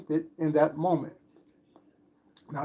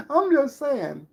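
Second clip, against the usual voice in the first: amused; 9 semitones higher, 5 dB louder, slower than usual. Real, not acted. Still laughing, high and loud.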